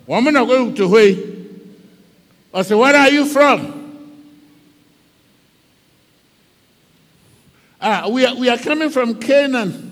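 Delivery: stern Joseph questioning. Acted. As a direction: question the strangers sharply. Joseph questions his brothers.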